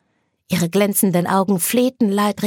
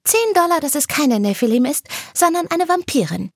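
high, cheeky voice; Anna betting back; 9 semitones higher than usual. Acted. Anna betting Kane ten dollars, high and cheeky. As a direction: toss the counter-bet playfully.

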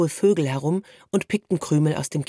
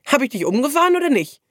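high, playful challenge; good-humoured self-defence, pitched up; amused protest; 10 semitones higher, 4 dB louder, faster than usual. A narrator's reading. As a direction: argue back in mock indignation. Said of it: "wehrte der Junge sich lachend".

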